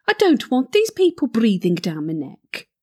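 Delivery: usual speaking voice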